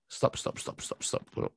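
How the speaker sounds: whispered, insistent stop; God hushing him in a whisper; hushed stop. Retold or imitated. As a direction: cut him off in a hush. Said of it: "saya nggak dikte Tuhan".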